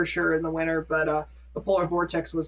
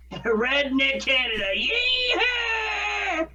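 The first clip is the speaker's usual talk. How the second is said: mock yeehaw; 9 semitones higher, much slower than usual. Joking about northern Minnesota.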